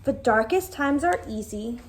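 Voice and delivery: plain reading voice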